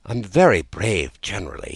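ordinary delivery, nothing marked

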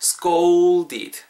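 "scolded" with a long, heavily hit "ou"; punched OU sound. Shown as a demonstration. Teacher corrects learners, "scolded" slowly with a big drawn-out "ou". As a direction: stretch and punch the middle of "scolded". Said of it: "우, 울 때를 팍팍 치는 거예요".

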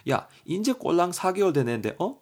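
high-pitched indignant scolding; mock-berate them for a broken promise; puppy owner reproaches a friend, indignant; acted anger. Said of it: "이렇게 막 화를 내는 거죠".

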